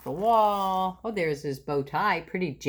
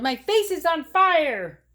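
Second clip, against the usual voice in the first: high loud slow cry; 10 semitones higher, 5 dB louder than usual. Acted. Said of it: "he yelled".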